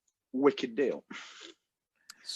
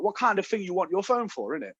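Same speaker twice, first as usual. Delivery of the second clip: put-on raised-pitch phone salesman accent; Aaron's accent; 6 semitones higher than usual. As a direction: mimic the salesman's accent, higher than your own. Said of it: "I'm not exaggerating this accent".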